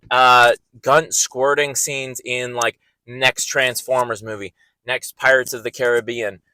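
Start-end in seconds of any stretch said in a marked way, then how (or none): none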